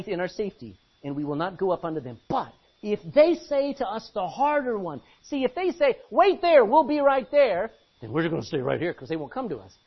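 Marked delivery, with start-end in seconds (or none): 6.1-7.7 loud, high warning shout; loud call to wait; soldier call. Acted. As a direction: call across to the intruders. Philistines calling out.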